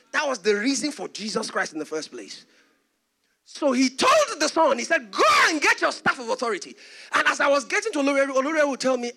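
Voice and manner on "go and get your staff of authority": raised, forceful order; fatherly command. Retold or imitated. Father ordering his son back, loud and commanding. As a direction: send him off firmly, acting the father.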